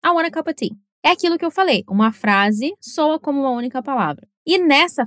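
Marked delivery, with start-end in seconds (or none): 0.0-0.7 "I want a cup of tea" run together as one word, "wanna" and "cuppa"; relaxed native reduction; native connected speech. Shown as a demonstration. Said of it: "O que você vai ouvir na realidade é".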